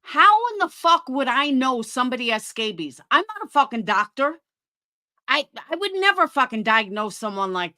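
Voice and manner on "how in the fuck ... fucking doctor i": normal talking voice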